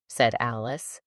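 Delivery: ordinary delivery, nothing marked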